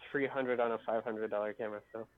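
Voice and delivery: ordinary talk, nothing marked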